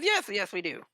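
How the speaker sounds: a jumpy, eager inn host; lightly voiced fussy innkeeper.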